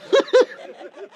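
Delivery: high, breath-filled giggle; wicked giggle. Acted. Villain savours the toxin, wicked giggle. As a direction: let a mad little giggle slip out.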